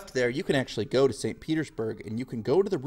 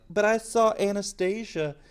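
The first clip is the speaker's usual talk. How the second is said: higher, slightly whiny voice, slowing at the end; mock student objection; 5 semitones higher than usual. Students citing Anastasia the movie, lightly whiny protest. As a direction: play a know-it-all student. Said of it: "some of you are like".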